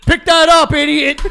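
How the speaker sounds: loud, high furious dad yell. Retold or imitated. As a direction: shout it like an angry father. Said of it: "things your dad has yelled".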